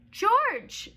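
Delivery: a loud high call of "George"; cried out. Acted. Man in the yellow hat finding George, calling out. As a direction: call his name out with relief. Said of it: "the man cried".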